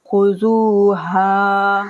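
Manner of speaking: slow recited word; Quran recitation. Shown as a demonstration. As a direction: chant the word slowly. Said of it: "teacher recites word slowly".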